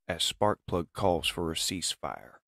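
plain reading voice

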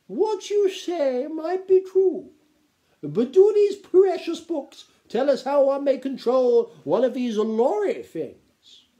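slow, high, loud grande dame; piping, stately aristocratic lady; imperious baroness. A narrator's reading. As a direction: challenge him with grand disdain.

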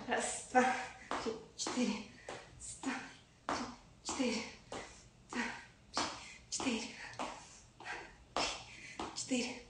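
quiet count fading into a whisper; counting workout reps. Real, not acted. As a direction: count reps under your breath, trailing off.

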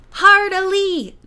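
high, loud, drawn-out call; sailor's yell. A narrator's reading. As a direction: call it out slowly across a deck. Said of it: "yelling out sailor stuff".

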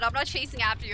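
normal talking voice

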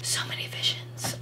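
hushed, voiceless whisper. Pretend. Vlogger repeats her excitement over outfit ideas, whispered. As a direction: drop right down into a secretive whisper.

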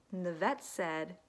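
ordinary delivery, nothing marked

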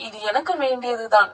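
ordinary delivery, nothing marked

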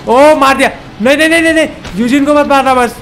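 high, urgent string of no's; alarmed plea. Real, not acted. Gamer begging the enemy not to hit Eugene, alarmed. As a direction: plead in sudden alarm, pitch jumping up.

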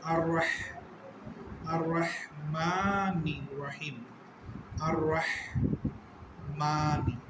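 drawn-out Quranic recitation; slow recitation. Shown as a demonstration. Teacher reciting for his students, slow chanted recitation. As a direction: recite it very slowly, stretching each vowel. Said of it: "teacher recites for the class".

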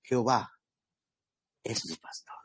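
Jehová es mi pastor whispered, dropping to a hush on "es mi pastor"; felt whisper. Shown as a demonstration. Preacher showing the flock heartfelt recital, whispered. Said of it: "que decir".

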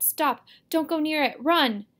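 urgent, high and loud; shouted warning. A narrator's reading. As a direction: shout to stop someone walking into danger. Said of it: "I shouted".

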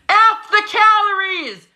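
high, slow rallying yell. Acted. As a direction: belt it out like a battle cry. Chant building to a yell.

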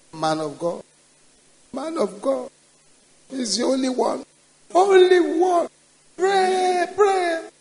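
retold plea rising into a high crying shout. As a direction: beg through tears, building to a wail. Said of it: "she now brought the boy, crying".

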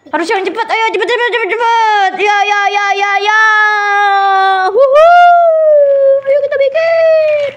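high, rapid urging into a long whoop; excited cheer. Real, not acted. Host hurrying child to start building, excited cheering.

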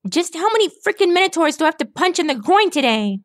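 fast, high-pitched rant; exasperated shouting. A narrator's reading. Rainbow Dash pinned by a minotaur, exasperated fast outburst. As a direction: rattle it off, high and fed up. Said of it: "she shouted".

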